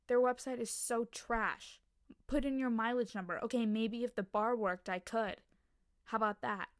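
loud exasperated complaining; annoyed rant. Acted. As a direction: vent at a broken website, loudly. Acted rant at airline website.

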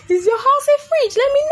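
very high, loud squealed question; mock outraged squeal. Girl taunting a boy about his house, mock outraged squeal. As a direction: squeal it at the top of your range. Repeats the jibe, far higher, louder.

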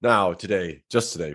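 usual speaking voice

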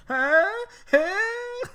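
high, squeaky startled huhs; imitated frozen panic noises. Mom frozen as son dangles, imitated panic squeaks. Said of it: "Why are you making those sounds?".

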